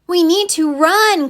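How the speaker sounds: a small creature crying out in fright; frightened cry. A narrator's reading. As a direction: cry out to flee. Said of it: "cried Pip".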